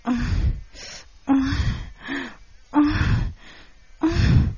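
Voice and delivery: high, drawn-out moans; breathy moans of pleasure, climbing in pitch; moaning. Acted. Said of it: "ella gemía".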